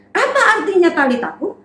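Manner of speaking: plain reading voice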